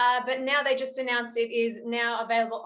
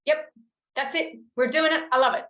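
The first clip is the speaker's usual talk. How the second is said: higher, excited approval, getting louder by the end; delighted director; 5 semitones higher, 4 dB louder than usual. Retold or imitated. Director to actress after the accent take, excited approval. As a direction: imitate a director thrilled by the take. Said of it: "She went".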